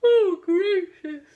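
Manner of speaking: talking through a big real yawn. Real, not acted. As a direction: let a yawn swallow the words. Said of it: "sorry, I'm yawning".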